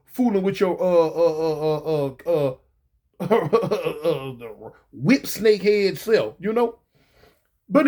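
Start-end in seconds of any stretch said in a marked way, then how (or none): none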